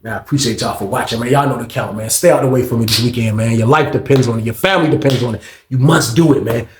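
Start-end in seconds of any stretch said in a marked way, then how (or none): none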